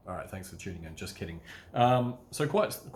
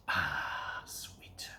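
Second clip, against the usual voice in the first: pleased whisper; 5 semitones lower, 5 dB quieter than usual. Pretend. Acted reaction to getting cash.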